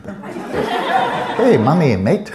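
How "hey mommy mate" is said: loud, high, hammed-up Aussie kid; bad Australian accent. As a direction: ham up a bad Australian accent through laughter. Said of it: "I can't mimic the Australian accent".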